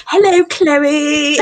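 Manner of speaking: echoing the greeting loudly with a laugh; loud teasing British hello; mock British hello. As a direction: bounce the name back in a put-on British lilt. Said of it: "said your name like that".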